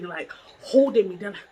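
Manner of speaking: ordinary talk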